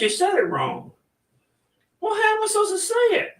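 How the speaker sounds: high squeaky chicken voices bickering; squabbling chickens. Acted. Chickens bicker over the microphone.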